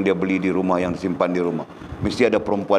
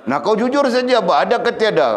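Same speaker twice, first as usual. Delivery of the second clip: mock interrogation; 11 semitones higher, 4 dB louder than usual. Real, not acted. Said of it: "teasing a congregant".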